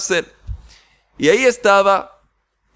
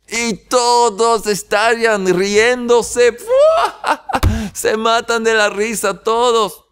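contar entre risas fuertes, la voz se sube mucho; riendo a carcajadas; 6 semitones higher than usual. Acted. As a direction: se le escapa la risa imitando a los presos. Los presos se ríen a carcajadas. (entre carcajadas, imitando a los presos que se ríen)

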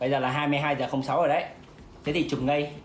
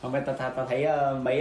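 usual speaking voice

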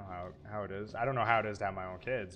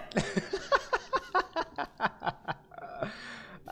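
nervous laugh; 12 semitones higher, 3 dB louder than usual. Real, not acted. Nervous laugh before descending.

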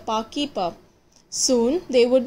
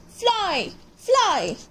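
urgent urging; 7 semitones higher than usual. Acted. Urging falling bird to fly.

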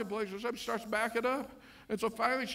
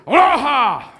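a loud, beaming, louder aloha with a laugh; intense happy aloha; 5 semitones higher, 18 dB louder, slower than usual. Retold or imitated. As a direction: blast it out joyfully. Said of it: "very much intense, happy".

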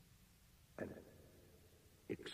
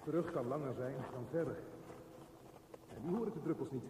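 louder, higher, more urgent; rising alarm; 5 semitones higher, 6 dB louder, faster than usual. Acted. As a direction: push it out as panic builds. Monologue, mounting alarm. (steeds luider en paniekeriger)

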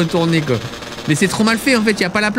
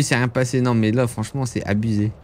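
ordinary talk

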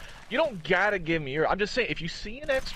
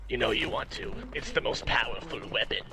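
evil villain voice; 7 semitones lower, 4 dB quieter than usual. Acted. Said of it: "my best, uh, evil villain impression".

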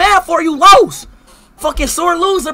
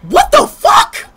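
a much higher, slow, cracking shout; outraged disbelief; 12 semitones higher, much slower than usual. Real, not acted. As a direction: scream it out in real shock. Reacts to racist insult.

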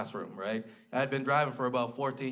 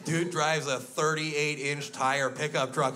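ordinary delivery

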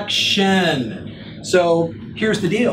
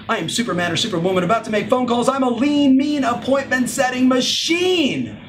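mock superhero boast; 6 semitones higher than usual. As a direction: puff up and bellow like a sales superhero. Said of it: "Not like".

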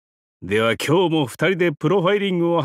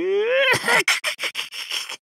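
high cackle. Acted. After boasting of the ruined feast.

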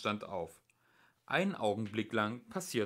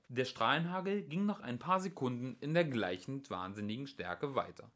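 plain reading voice

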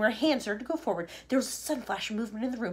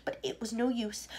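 usual speaking voice

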